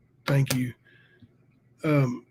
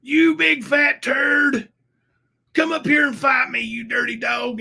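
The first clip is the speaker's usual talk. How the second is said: higher, slow furious yell; angry yell; 9 semitones higher, 4 dB louder, slower than usual. Retold or imitated. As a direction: scream the insults at him. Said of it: "I screamed and I yelled at Jamie".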